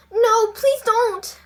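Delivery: high, loud, drawn-out plea; alarmed high protest; crying out. A narrator's reading. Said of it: "cried Maggie".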